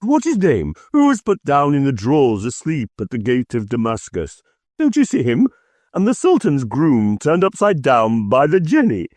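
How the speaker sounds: put-on high, eager childlike rush; between laughing and crying. A narrator's reading. Scrooge thrilled by storybook heroes, high eager voice. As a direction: relive storybook heroes with glee. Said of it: "extraordinary voice between laughing and crying".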